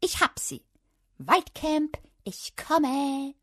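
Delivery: very high, triumphant calling; jubilant cry. A narrator's reading. Child celebrating before forest camp, jubilant. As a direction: sing it out in celebration. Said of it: "rief ich popowackeltanzend".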